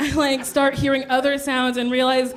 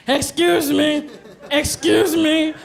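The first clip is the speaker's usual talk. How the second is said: put-on drunken demand; 3 semitones higher than usual. Drunk driver to drive-through worker, slurred mock demand.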